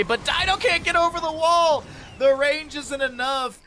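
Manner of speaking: excited. Real, not acted. Caster hype on missed escape.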